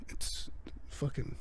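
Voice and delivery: hushed aside under the breath; real whispered curse. Real, not acted. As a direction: drop to a sudden whisper. Sister on speakerphone interrupts.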